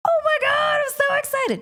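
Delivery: very high-pitched mock squeal of joy; hammed-up excitement. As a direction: squeak it out like a thrilled fan. Acting out excitement at being asked.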